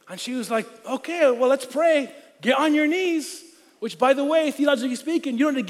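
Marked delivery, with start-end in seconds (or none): 0.8-3.4 higher, slow and brisk; mock mother. Mother telling teen to kneel, higher and slow. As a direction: imitate mom ordering him to kneel. Mother answering teen's confession.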